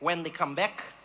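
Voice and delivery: put-on deadpan reply in an odd Dutch-ish accent; weird Dutch-like accent. Local man answering about the strike, weird Dutch-like accent. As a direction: echo it back with a not-quite-Dutch accent. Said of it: "like, Dutch, but it wasn't Dutch accent".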